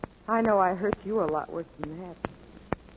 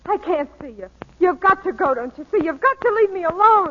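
raised, insistent plea; distressed pleading; 8 semitones higher, 7 dB louder than usual. Acted. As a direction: beg him urgently to leave, louder as it builds. Pleads with him to leave.

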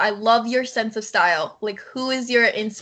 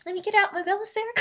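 put-on childish gushing voice; 9 semitones higher, 5 dB quieter, much faster than usual. Mock excited kid quote.

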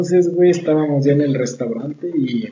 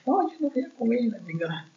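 much higher, pouty mock girlfriend; mock whiny refusal; 8 semitones higher, 10 dB quieter than usual. Mocking his date's refusal to eat, high and pouty. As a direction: squeak out a spoiled refusal.

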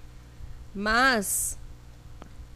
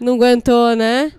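excited; 11 dB louder than usual. Real, not acted. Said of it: "Tô tão empolgada".

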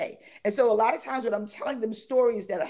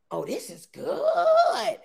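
Karen delighted; much slower than usual. Retold or imitated. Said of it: "she's like".

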